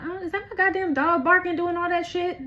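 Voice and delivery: high, exasperated outburst; sudden irritation at a noise; annoyed surprise. Real, not acted. Dog barking interrupts reading.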